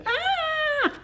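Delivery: sudden high squeal of a tiny bug; quick startled squeak; high ladybug shriek. A narrator's reading.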